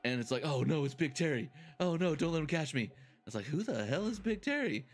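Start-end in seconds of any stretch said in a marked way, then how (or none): 0.4-2.9 lightly acted, higher alarm; hammed-up worry; mock panic. Retold skit, frightened inmate. 3.5-4.9 higher, puzzled imitation; mock bewilderment; baffled newcomer. Retold skit, baffled newcomer.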